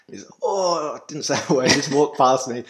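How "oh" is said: pained groan. Retold or imitated. Injured brother groaning.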